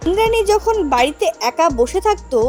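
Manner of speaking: usual speaking voice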